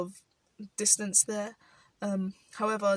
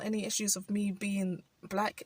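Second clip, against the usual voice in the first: usual speaking voice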